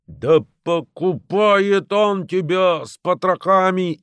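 high, slow hysterical yell; frantic shouting hostage taker. A narrator's reading. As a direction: scream it hysterically. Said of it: "истерично закричал Фикс".

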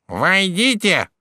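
slow, high, nasal "come in". A narrator's reading. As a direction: drawl it through the nose. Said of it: "раздался гнусавый голос".

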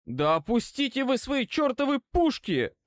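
high, exasperated outburst; сдавленный крик. A narrator's reading. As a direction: plead and order at once, pitch high. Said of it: "прохрипел я". (раздражённо, высоким сдавленным голосом)

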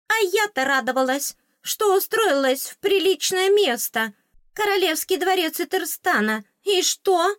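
very high, loud squeaky complaining; a tiny fairy wailing at her bad luck; high pathetic fairy whine; theatrical fairy lament. A narrator's reading.

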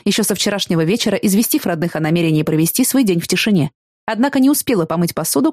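ordinary delivery

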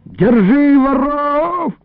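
high, drawn-out alarm cry; yelling. A narrator's reading. Illarion to thieves from a tree, yelling. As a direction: holler high, stretching the vowels. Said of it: "заорал вдруг Илларион".

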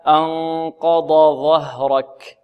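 أنقض ظهرك slow, ض then a separate ظ; slow correct recitation. Shown as a demonstration. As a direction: model أنقض ظهرك carefully. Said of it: "هكذا".

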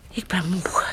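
tired whisper; weary whispered sigh. Acted. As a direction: breathe it out wearily. Tired old mother.

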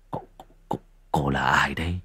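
breathless, whispered stammer; frightened whisper, stumbling on each word; stammering in fear. Acted. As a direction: barely get the words out, terrified. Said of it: "Thịnh ú ớ".

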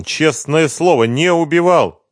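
slow, high plaintive insistence; muttered denial. A narrator's reading. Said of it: "пробормотал он".

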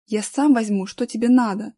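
ordinary delivery, nothing marked

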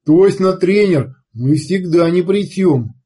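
loud, slow, grinning thug; a big grin behind every word; grinning brute. A narrator's reading. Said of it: "Булыжник широко улыбнулся".

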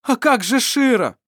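high, loud, pleading cry; alarmed. Acted. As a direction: Vassar begging Mar to think of Shira, high and loud. Vassar pleads for Shira.

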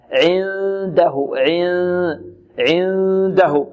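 very slow عنده, long nasal hum before the d; hidden noon. Shown as a demonstration. Teacher demonstrating ikhfaa, drawn-out nasal noon. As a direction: hold the ن of عنده through the nose, tongue ready for د. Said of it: "ويكون الخارج من الخيشوم في الإخفاء أكثر".

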